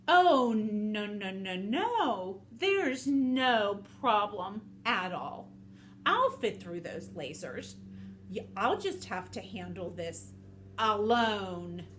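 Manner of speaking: slow, drawn-out words; oozing false confidence; scheming snake, falsely reassuring. Acted. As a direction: stretch it out like a sly storybook snake.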